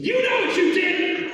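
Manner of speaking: very high, loud accusing yell; slow, high-pitched hammed-up police yell; angry cop shout. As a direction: explode as an officer shouting down a suspect. Retold officer yells at terrified kid.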